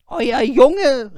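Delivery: high, loud accusing cry. Acted. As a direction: storm in with the news. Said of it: "rief sie".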